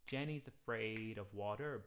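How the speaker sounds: slow, water placed before beat three; counting the rhythm of water; counted rhythm. Shown as a demonstration. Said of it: "of water is just an anticipation of beat three".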